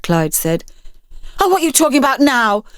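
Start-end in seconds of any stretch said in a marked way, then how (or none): 1.4-2.6 fast, high exasperation; shot back. Acted. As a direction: snap it out. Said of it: "the girl shot back".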